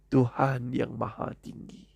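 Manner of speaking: usual speaking voice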